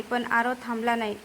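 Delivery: plain reading voice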